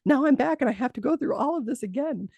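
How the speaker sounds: retold exasperated complaint. Revived patient scolding the nurse who fetched him, mock exasperation. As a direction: act out an indignant grievance.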